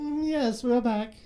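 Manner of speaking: a light Irish character voice; Peeves voice. Pretend. Peeves agrees the show is back, lightly voiced. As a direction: slip back into Peeves' brogue.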